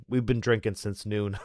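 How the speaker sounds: ordinary talk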